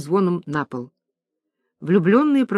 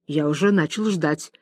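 usual speaking voice

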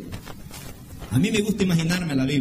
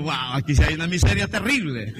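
laughing; 5 semitones lower than usual. Retold or imitated. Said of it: "Digo yo".

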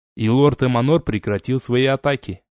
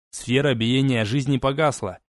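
ordinary delivery

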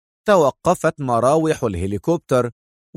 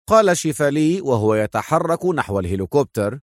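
ordinary delivery, nothing marked

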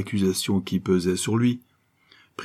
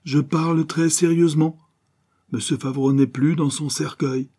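high, forceful insistence; insistent seriousness; 8 semitones higher, 4 dB louder than usual. A narrator's reading. Adventuress answering the detective's disbelief, forcefully insistent. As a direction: shut down any idea of a joke, voice raised.

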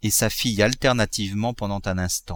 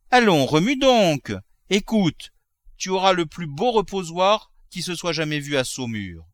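high-pitched, insistent cajoling; eager coaxing; 7 semitones higher than usual. Acted. Husband promising his sick wife a fine altar, eager coaxing. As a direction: coax her back to life with promises.

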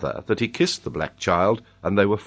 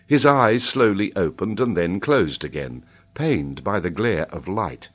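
ordinary delivery, nothing marked

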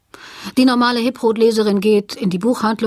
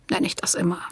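geflüstert; 7 dB quieter than usual. Real, not acted. Leise Pointe am Satzende.

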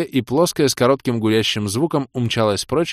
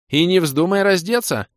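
high, indignant order; snarled warning; 9 semitones higher, 4 dB louder than usual. A narrator's reading. Кира forbids him to undress, snarling. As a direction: scold him in a raised voice. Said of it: "рыкнула Кира".

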